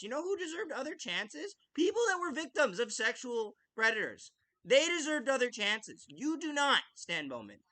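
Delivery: real outrage building at a hockey executive; angry rant. Real, not acted. Angry rant at Stan Bowman.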